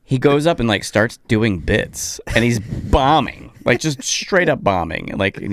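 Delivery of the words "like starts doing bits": ordinary talk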